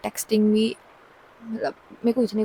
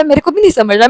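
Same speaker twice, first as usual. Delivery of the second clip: higher and louder, flustered; answering the friend in a panic; panicked reply; 4 semitones higher, 7 dB louder, faster than usual. Retold or imitated. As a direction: blurt it out in a panic.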